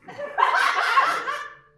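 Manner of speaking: high, loud nervous burst of laughter. Acted. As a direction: laugh out high in sheer nerves. Nervous suitor before proposing.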